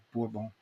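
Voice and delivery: Bourbon said softly as boor-BON; French pronunciation. Shown as a demonstration. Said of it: "I had not heard it pronounced that way".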